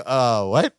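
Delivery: low, baffled mock voice; confused Balky. Host admitting they missed the joke, low confused Balky. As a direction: drop low as if utterly lost. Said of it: "I totally pulled a Balky going".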